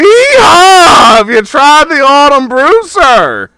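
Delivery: loud high donkey bray into a sales pitch; loud high donkey mascot voice; braying donkey man. Acted. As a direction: bray like a costumed donkey hawker. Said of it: "I don't know why I said hee haw".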